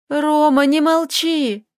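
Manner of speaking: put-on girl yelling into the phone at silent Roma; hoarse shout. A narrator's reading. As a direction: shout at him to answer. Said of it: "закричала она хрипло".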